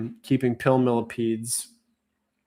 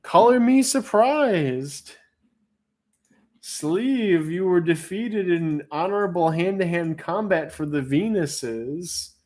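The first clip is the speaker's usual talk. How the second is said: announcer; 8 semitones higher, slower than usual. Acted. Auction winner announced.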